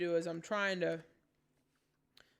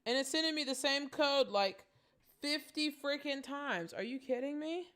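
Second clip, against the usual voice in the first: frustrated rant; 6 semitones higher, 3 dB louder than usual. Real, not acted. Same code fifty times.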